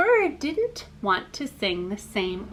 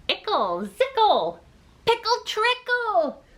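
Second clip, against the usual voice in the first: rising into a high silly chant; playful rising nonsense; 11 semitones higher than usual. Acted. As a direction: build the silliness up high. Cardinal's silly rhyme.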